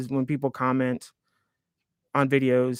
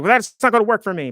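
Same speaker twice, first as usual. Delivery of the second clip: stubborn retort; 7 semitones higher, 6 dB louder than usual. Retold or imitated. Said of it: "you wanna be like".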